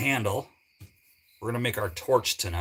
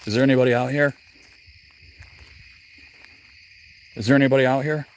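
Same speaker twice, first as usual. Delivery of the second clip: louder calling into the dark, playing ghost hunter; 6 dB louder, much faster than usual. Ghost hunter calling to spirits, loud pretend call. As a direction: call out to empty woods, mock spooky. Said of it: "pretend I'm on a ghost hunt".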